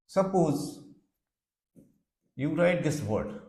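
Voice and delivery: ordinary talk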